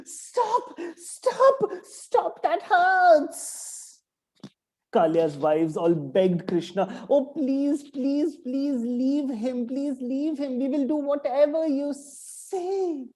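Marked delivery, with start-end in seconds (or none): 0.0-3.7 very high, pleading snake voice crying out; pained snake cries. Acted. 7.1-13.1 begging wives. Acted. Snake wives beg Krishna.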